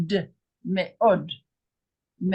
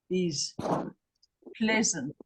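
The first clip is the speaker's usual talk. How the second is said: ordinary talk, nothing marked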